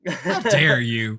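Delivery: mock outraged. Acted outrage as Thor.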